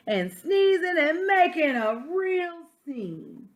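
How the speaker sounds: reading a picture book aloud as the wolf; story narration. Acted. Teacher reading to children, storytelling voice. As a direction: tell it to the class like the wolf's own tale.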